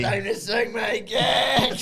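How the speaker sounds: high, drawn-out strained yell; mock yelling. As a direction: wail it out slowly, pitched high.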